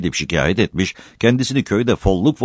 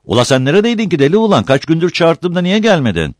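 high, raised-voice demand; loud challenge; 12 semitones higher, 5 dB louder than usual. A narrator's reading. Agha questioning the latecomer who ignored his summons, loud challenge. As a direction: call him to account, high and quick.

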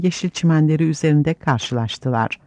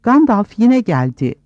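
raised, high-pitched announcement; mild excited shout; 7 semitones higher, 4 dB louder than usual. A narrator's reading. Aragorn announcing Gandalf's return, lightly shouting. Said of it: "diye bağırdı Aragorn".

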